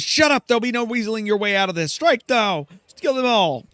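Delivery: Silke. Acted. Silke shouting her guards into the attack, loud high voice. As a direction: cut them off and order the kill. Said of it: "Silke goes".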